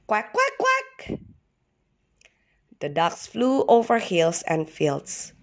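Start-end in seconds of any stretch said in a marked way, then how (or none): 0.1-1.2 high sing-song quacking; duck song quacks. A narrator's reading. Ducks singing duck songs in flight, high quacks. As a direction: quack it like a little tune, up high. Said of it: "the ducks even sang duck songs".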